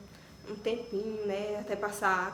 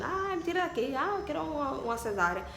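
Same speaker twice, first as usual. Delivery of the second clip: pained plea; 5 semitones higher than usual. Retold or imitated. Retelling labor cries.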